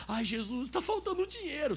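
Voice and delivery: high-pitched mock complaint to Jesus; whiny believer's plea. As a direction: imitate someone whining in prayer. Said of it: "você chega assim pra Jesus".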